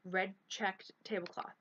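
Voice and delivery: usual speaking voice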